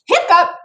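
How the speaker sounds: loud high hiccup; big hiccup. Acted.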